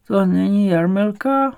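put-on high, slow old woman; old woman's voice. A narrator's reading. Old woman in bed asking after granddaughter, high slow voice. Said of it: "opakoval ženský hlas".

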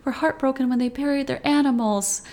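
hint of a fretting mother; overprotective mom. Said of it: "a little overprotective mom vibes".

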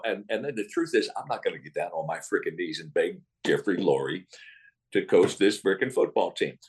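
low, aggrieved coach rant; a lightly voiced angry old coach; Dick Vermeil impression. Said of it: "Vermeil got pissed off in an interview".

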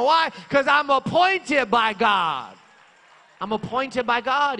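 loud, high, fervent preacher's cry; shouting praise from the pulpit; full-force preaching. Acted. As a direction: preach it out to the whole church. Preacher rebuking devil, rising.

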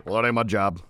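low, muted slacker voice; disengaged employee. Acted. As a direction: grumble it low like an uncaring worker.

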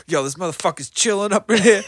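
high, loud mock narration of a friend's story; hyped storyteller imitation. Listener teasing the storyteller by mimicking him, hyped-up. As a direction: exaggerate a buddy's excited slangy storytelling. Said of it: "I love the way you narrated it".

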